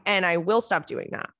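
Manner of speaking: ordinary talk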